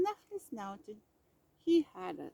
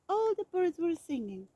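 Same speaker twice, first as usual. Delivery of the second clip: plain reading voice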